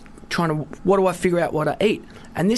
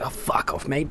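muttered aside; 6 semitones lower, 8 dB quieter than usual. Retold or imitated. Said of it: "And you're like".

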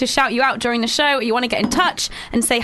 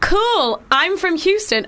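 put-on Texan accent; 6 semitones higher, slower than usual. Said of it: "Nope, not gonna do it".

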